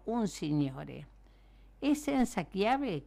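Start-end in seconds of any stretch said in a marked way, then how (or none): 1.8-3.1 high, slow raised-voice question; mild storybook shout, pitched high; calling up to be heard. A narrator's reading. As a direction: call it out slowly so it carries. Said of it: "gridando per farsi sentire".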